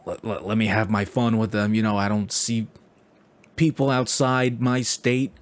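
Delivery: a hint of a scheming New York old-timer; sly New Yorker accent, half-hearted; New York stranger plotting fun with tourists, mild accent; lightly voiced New Yorker. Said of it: "this guy's like".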